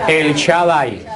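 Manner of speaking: "Shaddai" dicho lento y marcado, ch al inicio; pronunciando Shaddai. Shown as a demonstration. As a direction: separa despacio el nombre "Shaddai". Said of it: "La única vez que se menciona". (pronuncia "el Shaddai" muy despacio, sílaba a sílaba)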